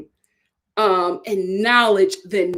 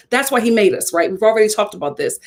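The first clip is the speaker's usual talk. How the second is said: ordinary delivery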